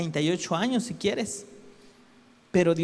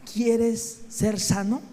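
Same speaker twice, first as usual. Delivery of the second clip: incredulous mocking question; 2 semitones higher, much slower than usual. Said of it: "un poco ridícula esta palabra, esta pregunta".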